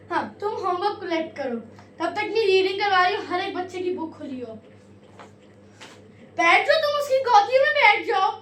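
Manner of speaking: a girl playing her strict teacher, loud and high. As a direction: scold the class like a strict Urdu teacher. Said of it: "strict teacher part one".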